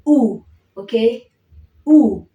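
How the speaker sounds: U said loud, lips folding; vowel U. Shown as a demonstration. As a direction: fold the lips and say U. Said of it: "So when I'm saying U".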